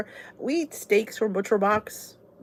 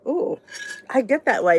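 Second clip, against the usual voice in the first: normal talking voice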